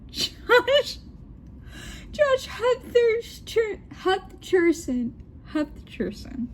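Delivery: put-on high, drawn-out British accent, cracking up. British daughter names her celebrity crush, accent wobbling with laughter. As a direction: squeal it in British, then settle down slowly. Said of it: "her British Kate".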